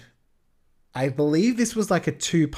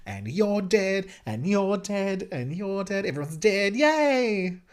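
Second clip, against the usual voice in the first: higher, slow, sing-song gloating; mock-gleeful higher sing-song ending in a cheer; gleeful power trip; 6 semitones higher, slower than usual. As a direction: dole out deaths like a delighted child. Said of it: "this would be fun to have this power".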